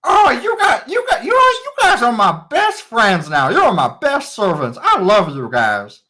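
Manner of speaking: put-on a spoiled young king gushing at his servants; gushing boy king. Young king gushes over his servants, high and eager.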